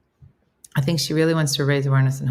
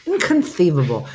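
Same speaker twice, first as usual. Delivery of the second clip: lightly lisped film-villain quote; a hint of the Princess Bride catchphrase; movie-quote exclamation; 4 dB louder than usual. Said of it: "that's how I have to say it".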